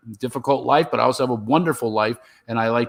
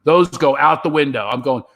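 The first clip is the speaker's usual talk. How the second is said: normal talking voice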